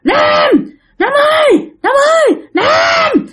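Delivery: high-pitched, insistent repeated name call; exasperated, angry calling. Retold or imitated. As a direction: keep calling shrilly, fed up with being ignored. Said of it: "kêu cái kiểu tức tối vậy đó".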